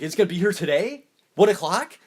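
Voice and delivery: put-on like a kid waiting for a gift; mock eager Tom Cruise; excited Cruise. Cruise excited about car.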